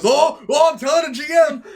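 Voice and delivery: high, loud tattling voice; hammed-up "I'm telling" outcry; mock tattletale. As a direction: imitate an outraged snitch. Player threatening to tattle.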